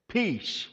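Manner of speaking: 'Peace rings out high and drawn, then falls; stress on peace. Shown as a demonstration. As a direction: lift 'Peace far above the rest and let it drop. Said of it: "I want to emphasize that word peace".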